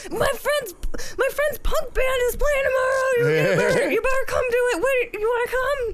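put-on high, loud, giddy drunk voice; drunk self voice. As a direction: squeal it like a tipsy Muppet. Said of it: "that's my drunk self voice".

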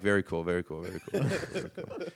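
soft, repetitive naive act; hammed-up naive muttering; playing naive. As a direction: act the clueless guy, quietly impressed. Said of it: "I'm playing just naive".

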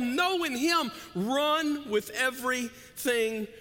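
very loud, high, shouted preaching; Paul's charge roared to the crowd; preaching at full force. Acted. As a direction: pour everything into it.

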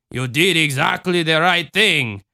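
put-on lilting Cajun accent; reassuring Cajun mother. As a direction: reassure a child in Cajun tones. Voices Ma Marie.

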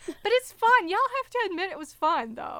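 laughing, high and quick. Real, not acted. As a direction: giggle through it, insisting. Laughing insisting it was fun.

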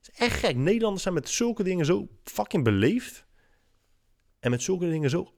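high-pitched incredulous rant; exasperated. Real, not acted. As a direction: marvel at it in disbelief. Exasperated rant about Dutch politeness.

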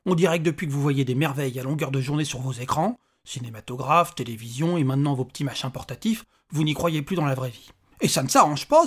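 indignant little man on a tirade; exasperated little stranger. Acted. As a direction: vent about these disbelievers.

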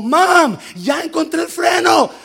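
put-on high, loud child's shout; retold daughter yelling to her mom. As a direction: call out proudly to mom from the car.

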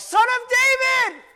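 drawn-out, high, very loud cry; all-out pleading shout; top-of-the-lungs yell. Acted. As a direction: scream for mercy over the crowd. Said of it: "I yelled at the top of my lungs".

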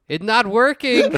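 put-on loud, high Mexican-accented dismay; despairing put-on Mexican accent, for laughs; mock Mexican accent. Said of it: "with a Mexican accent".

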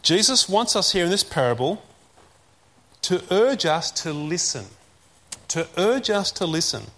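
normal talking voice

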